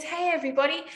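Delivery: bright, high hello calling in; cheery greeting. Retold or imitated. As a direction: breeze in calling out to the family. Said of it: "He goes".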